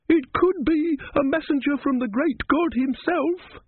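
high, slow, awed believer's voice; a pious woman hushed with wonder; devout woman. A narrator's reading. Devout woman's fervent claim.

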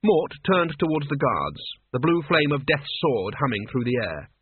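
ordinary delivery, nothing marked

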